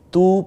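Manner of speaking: তু held long and high; drawn-out tu. Shown as a demonstration. Teacher showing the long vowel in তু. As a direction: sing out the vowel in তু. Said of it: "আমরা বলবো তু".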